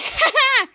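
loud, high mock laugh; acted laugh. Tiny character laughs before a swim, mock laughing. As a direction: put on a big high "ha ha".

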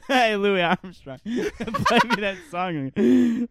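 loud, slow, laughing mimic; imagining calling out to a stranger, laughing; laughing mock greeting. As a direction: laugh through the imagined call-out. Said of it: "being like".

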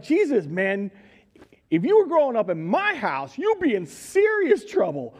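a father scolding his runaway son; mock stern dad. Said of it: "I read this and I'm like".

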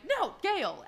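acted-out pleading objection; protesting. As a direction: call out a firm, high-pitched no. Man resisting unwanted advances.

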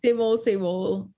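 normal talking voice

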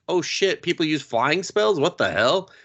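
high, gawking disbelief; mock astonishment. Townsfolk amazed by the flight, high and gawking. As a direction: gape at the flying spell. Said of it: "they're just".

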